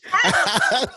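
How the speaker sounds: a loud high burst of laughter. Real, not acted. Cracking up when a friend calls him [name]. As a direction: crack up at the joke.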